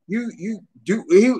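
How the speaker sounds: ordinary delivery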